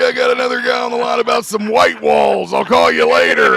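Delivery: high, loud smoker's rasp voice. Retold or imitated. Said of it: "you had that smoker's voice".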